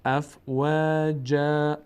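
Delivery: slow chant, long light a in "و" and "جا"; alef not full. Shown as a demonstration. Teacher reciting the alef, long thin a. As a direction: stretch "جا" without darkening the vowel. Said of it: "الف صدای آ اما پر نمیشه".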